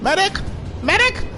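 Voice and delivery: high, rising call for a medic; man down shout. Acted. Downed in game calling for help.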